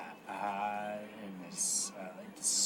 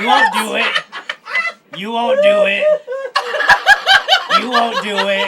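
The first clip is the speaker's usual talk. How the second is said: high, loud, laughing taunt; taunting dare; 16 dB louder than usual. Acted. Barkeep goads the player, loud laughing taunt. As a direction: goad him, daring him to do it.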